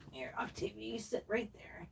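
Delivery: soft whisper to a doll being set down; whispered while settling the doll; gentle whisper. Real, not acted.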